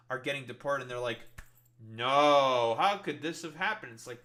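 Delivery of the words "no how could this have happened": loud drawn-out "no" of fake horror; hammed-up shocked victim; mock dismay. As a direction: wail it in mock disbelief. Mocks shocked voters' dismay.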